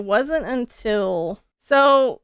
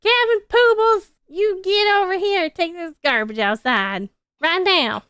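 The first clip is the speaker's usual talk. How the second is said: high, loud scolding mother's call; imagined mom hollering for her son to take out the garbage; scolding Southern mom; 10 semitones higher, 6 dB louder than usual. Retold or imitated. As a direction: yell him inside like a bossy Southern mom. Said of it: "I imagine Poodle's mom".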